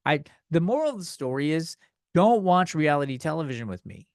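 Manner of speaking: ordinary delivery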